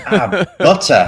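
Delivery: laughing out ah, butter, slow and loud. Real, not acted. Laughing at the butter joke, loud and amused. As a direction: caught out mid-laugh at the joke. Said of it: "butter's what you have on".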